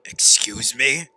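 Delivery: heated, loud outburst; angry snapping. Acted. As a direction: shut them down at full volume. Acted quarrel with another character.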